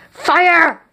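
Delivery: sharp shouted order to fire; shouted command. A narrator's reading. Executioner shouting the order to fire, loud.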